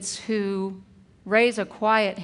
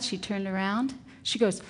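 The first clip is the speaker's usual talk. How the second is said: ordinary delivery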